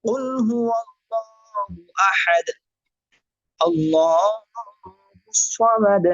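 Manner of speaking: slow melodic tajweed chant; Quran recitation. Shown as a demonstration. Teacher reciting for students in slow tajweed chant. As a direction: recite slowly, drawing out each vowel.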